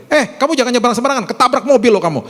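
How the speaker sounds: high, urgent mock scolding; sharp, high-pitched telling-off; negative parental warning. Shown as a demonstration. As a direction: imitate a parent snapping a careless threat. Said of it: "Seringkali kita ngomong gini sama anak kita".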